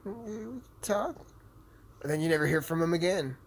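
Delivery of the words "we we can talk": much higher, put-on voice; sarcastic high-pitched agreement; mock reluctant reply. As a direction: play along in a silly high voice. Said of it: "I'm just like".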